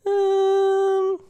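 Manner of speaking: very high, drawn-out falsetto hum; mock her indecision in falsetto; imitating wife. Wife unsure about steaks, high hesitant voice. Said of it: "she's like".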